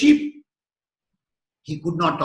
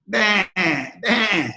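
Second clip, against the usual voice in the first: bleating like a sheep. Retold or imitated. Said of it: "So how do the sheep talk?".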